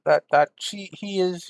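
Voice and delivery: ordinary delivery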